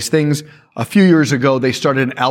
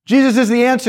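mock proclamation; 9 semitones higher, faster than usual. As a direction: proclaim it like an overeager street preacher. Mock preacher proclaiming to skeptic.

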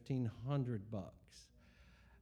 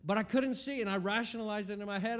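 ordinary delivery

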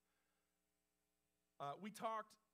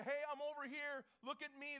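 higher-pitched call for attention; mock fan shout; 6 semitones higher, 4 dB louder than usual. Preacher mimicking a fan in the crowd, mock shout out. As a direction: imitate an eager fan calling out. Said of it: "This isn't like a fan shout out".

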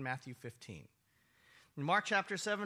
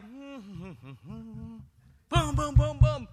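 booming knock sounds, loud; loud pounding noise; 4 semitones higher, 11 dB louder, much slower than usual. Acted. Woman pounding on the door, loud booming knocks. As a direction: bang it out like fists on a door.

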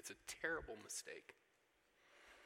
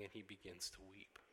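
hushed; 3 semitones lower, 8 dB quieter than usual. Real, not acted. Preacher telling the congregation Jesus weeps, hushed. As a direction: drop to a soft, hushed voice. Repeated line about Jesus weeping.

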